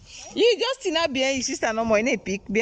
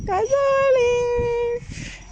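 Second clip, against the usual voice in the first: slow high announcing; 12 semitones higher, much slower than usual. Acted. Drawn out show label.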